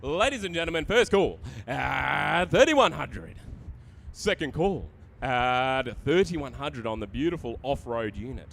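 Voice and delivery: rapid rolling auction call; auctioneer calling. Acted. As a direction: rattle off the bids fast. Live auction chant.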